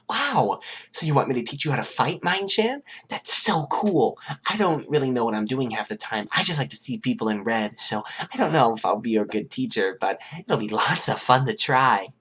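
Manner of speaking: put-on high, bubbly girl voice; a giddy, dangerous schoolgirl; Toga's voice. Toga testing names on a new friend, high and giddy.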